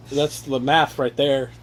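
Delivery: ordinary talk, nothing marked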